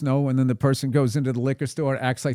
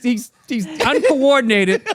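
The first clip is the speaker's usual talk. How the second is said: laughing; 7 semitones higher, slower than usual. Real, not acted. Laughing while telling story.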